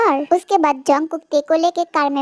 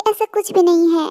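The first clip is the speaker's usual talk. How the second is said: ordinary delivery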